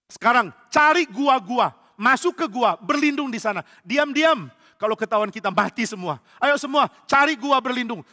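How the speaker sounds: high, loud urgent commands; panicked orders. Acted. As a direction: shout everyone into hiding, acted panic. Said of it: "Semua panik".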